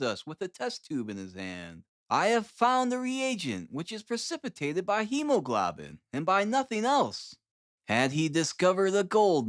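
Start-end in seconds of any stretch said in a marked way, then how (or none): 2.1-7.4 put-on higher, eager young scientist; eager Holmes. Holmes telling the visitors about his reagent, eager and high. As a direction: rattle it off in triumph.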